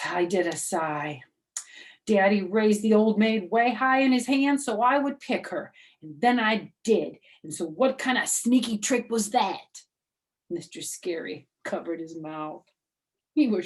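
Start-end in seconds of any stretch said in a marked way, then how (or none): 2.1-9.9 put-on higher, louder kid voice; indignant little girl. Junie B complaining to her teacher, higher and louder. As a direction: tattle like an outraged kid.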